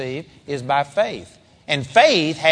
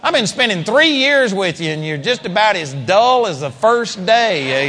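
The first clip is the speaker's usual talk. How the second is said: higher and louder, scolding; frustrated teacher; exasperated rebuke; 4 semitones higher, 3 dB louder than usual. Retold or imitated. Jesus scolding disciples continued.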